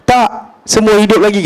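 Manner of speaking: high, urgent shout back; quick defiant retort. Retold or imitated. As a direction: fire the answer back at the enemy. (Omar menjawab dengan jeritan tinggi)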